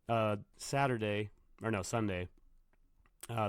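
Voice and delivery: usual speaking voice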